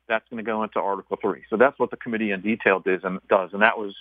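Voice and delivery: normal talking voice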